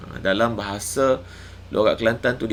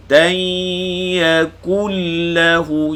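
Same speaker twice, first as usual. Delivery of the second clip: higher, louder Quranic chant, shin drawn out; Quran recitation; 7 semitones higher, 6 dB louder, much slower than usual. Shown as a demonstration. Teacher reciting a verse for students to follow, Quran chant. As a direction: recite yashfa' stretching the shin. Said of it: "Panjangkan dengung dia".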